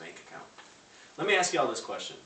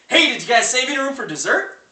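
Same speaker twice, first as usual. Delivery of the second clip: much higher and louder, bright sing-song server pitch; mock-chipper waitress upselling dessert; imitating a perky waitress offering dessert; cheery waitress; 13 semitones higher, 16 dB louder than usual. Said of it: "And she'd say".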